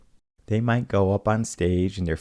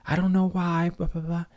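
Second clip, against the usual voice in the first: mock defensive yell; 11 semitones higher than usual. Retelling a fifth grader yelling in the hallway, mock defensive. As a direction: mimic a student snapping back, pitched up. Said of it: "And he's, like, yelling".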